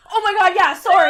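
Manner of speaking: loud, high mock apology; imitated flustered apology. Guest imitating the host's forgetful reply, loud mock flustered apology. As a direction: blurt it out high, imitating the host. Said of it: "And you were like".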